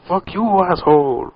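imitated drunk father. Friend retelling a prank on a drunk dad, imitating him. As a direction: lightly mimic a drunk man's insult. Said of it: "he's all drunk and fighting".

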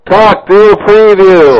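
loud, slow, drawn-out call; shouting into mic. Real, not acted. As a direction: bellow it right into the mic. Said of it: "you blew out the speaker".

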